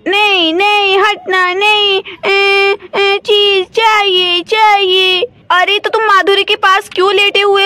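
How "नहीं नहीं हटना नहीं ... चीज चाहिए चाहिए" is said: put-on whiny child. Stubborn child whines for treats.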